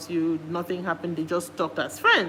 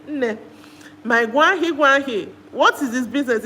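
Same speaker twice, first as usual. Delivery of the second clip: louder, higher exasperated call; exasperated scolding; 5 semitones higher, 6 dB louder than usual. Retold or imitated. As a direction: scold her like a frustrated auntie. Speaker scolds contestant by name.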